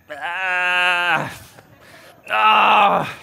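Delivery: a sigh then a rising loud ah ah; regretful sigh and groan. Retold or imitated. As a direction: groan at the wave you give up. Torn over losing wave.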